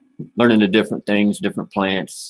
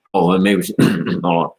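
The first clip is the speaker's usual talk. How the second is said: normal talking voice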